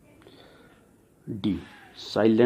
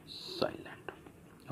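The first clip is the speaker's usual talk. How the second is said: whispered; 19 dB quieter, much slower than usual. Shown as a demonstration. Teacher drops to whisper.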